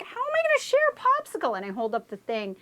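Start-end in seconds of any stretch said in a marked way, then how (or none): 0.0-1.6 put-on high kid voice. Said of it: "And I was like".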